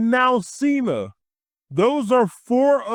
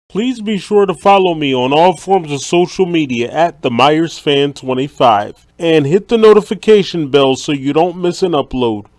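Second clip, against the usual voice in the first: much louder, lower sign-off pitch; loud outro plug; 5 semitones lower, 8 dB louder, faster than usual. Acted. Vlogger signing off to viewers, loud and low. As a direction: belt out the subscribe plug to close.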